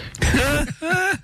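high, bursting laugh at the punchline; burst of laughter. Real, not acted. Laughs at his own joke.

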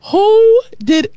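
"Who" stretched out long and high; drawn-out WHO. Shown as a demonstration. Preacher shows listeners how to ask it, drawn-out high WHO. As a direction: punch "Who" high, then drop on "did". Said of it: "you have to say it like that".

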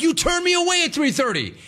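high, outraged squeak; indignant. Retold or imitated. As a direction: pitch it way up in disbelief. Indignant at clipboard man.

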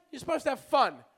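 normal talking voice

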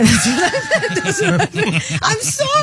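giggling through the words, rising high; can't stop laughing while apologizing; host cracking up as she says sorry. Real, not acted.

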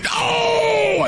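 very high mock shouted "No!"; crowd shout. People at the bar reacting to the insult, high shout. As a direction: yell like a whole bar in disbelief. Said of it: "people at the bar going".

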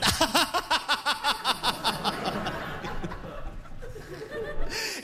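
long high mock evil cackle, trailing off; villain laugh. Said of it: "started laughing like a villain".

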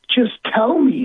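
high, urgent retold begging; insistent plea. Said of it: "and I kept saying".

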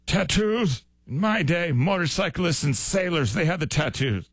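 high, grumbling mock disbelief; mock indignant old-timer. Host voicing old folks' gripe about tattoos, mock indignant. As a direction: complain like an old-timer, pitched well up.

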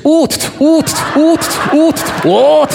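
mock camera clicks. Mimicking camera-clicking tourists.

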